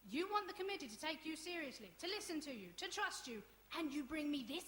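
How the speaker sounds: high-pitched exasperated reproach; indignant scolding. Acted. Acted row over the boys.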